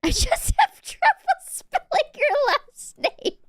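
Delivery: very high, drawn-out squeak; squeaky voice. Acted. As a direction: squeal it slowly through a laugh. Squeaky laughing reply to chat.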